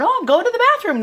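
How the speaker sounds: very high, loud mock-child voice; child's announcement. Recalling night trips to the outhouse, high mock-child announcement. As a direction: pitch way up, loud playful re-enactment.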